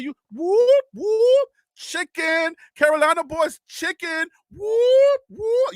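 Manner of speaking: high whooping clucks at a scared caller; mock him like a chicken; high, loud taunting whoop.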